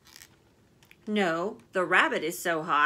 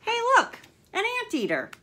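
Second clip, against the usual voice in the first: high, loud excited call; much higher and louder storybook excitement; excited discovery; 6 dB louder than usual. Acted. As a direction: point out the anteater with delight. Character spots new animal.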